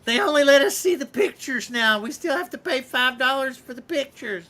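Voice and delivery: put-on high, creaky old-timer complaining; old man voice. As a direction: grumble like a nursing-home resident. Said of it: "old folks' home".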